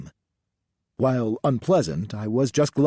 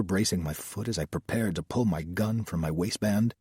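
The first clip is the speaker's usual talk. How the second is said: usual speaking voice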